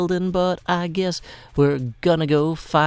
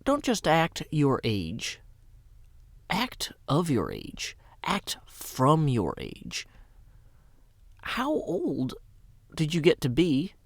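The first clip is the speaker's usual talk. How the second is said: poem reading; 6 dB quieter than usual. Acted. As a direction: read it like a quiet verse. Reads out a poetry prompt.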